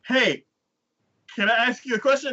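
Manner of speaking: high, innocent question; put-on high hero voice; friendly clueless hero. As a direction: ask like an oblivious hero. Hero approaches townsfolk, acted.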